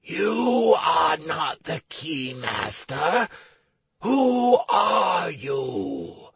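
slow booming demon challenge. Acted. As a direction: draw it out like a suspicious demon. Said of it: "one of the demons took a step forward".

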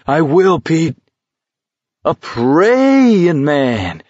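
high, drawn-out mock-preacher taunt; gleefully mocking revival cry; jibing. A narrator's reading. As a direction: jeer with a long, soaring stretched vowel. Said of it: "McVrees jibed".

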